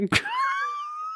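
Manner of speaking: piercing high squeal of a laugh; tea kettle laugh. Real, not acted. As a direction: shriek with laughter like a whistling kettle. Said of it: "my tea kettle laugh".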